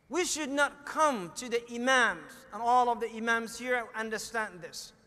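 ordinary talk, nothing marked

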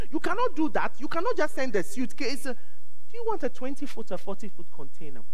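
put-on high, insistent African accent; shipper's wife scolds the pastor kindly, African accent. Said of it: "in an African accent".

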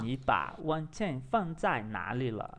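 slow, measured Mandarin practice; careful repetition. Shown as a demonstration. Learner copying the app's question, careful and slow. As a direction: echo the model slowly, word by word. Repeats the played model sentence.

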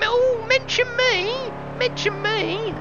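high, drawn-out begging voice; whiny mimicry. Streamer mocking a viewer who begs to be mentioned. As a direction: whine like a fan begging a shout-out.

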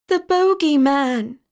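high, alarmed exclamation; frightened cry. A narrator's reading. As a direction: cry out like children fleeing in fear. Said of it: "ran away in fear, crying".

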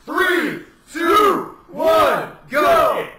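countdown rising into loud shouts; shouted countdown. Real, not acted. Host starting the contestant's timed turn, shouted countdown. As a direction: shout each number as a starting call.

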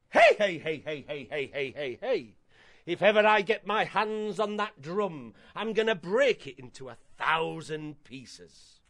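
put-on horrible old auntie with a cackling hey-hey; old lady voice. Horrible auntie threatens to break the drum, old lady voice. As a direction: threaten like a crotchety old woman. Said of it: "I shall do my best old lady voice for you".

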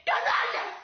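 high, full-force yell; explosive cry of outrage; outraged shout. Acted. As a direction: scream it at the villains. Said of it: "y grité".

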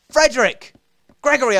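loud high call; shouting a name. Real, not acted. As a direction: call out across the map. Calling to the pet.

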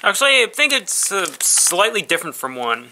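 talking while chewing; muffled by food; mouth partly full. Real, not acted. Seen 3: bit bar just before; bitten bar at mouth, jaw moving while speaking.

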